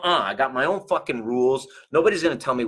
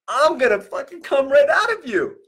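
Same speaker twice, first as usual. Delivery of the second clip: put-on much higher, pushy little-baby voice, loud; voicing the unborn baby; 13 semitones higher, 4 dB louder than usual. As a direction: play the baby threatening to burst out, high and loud.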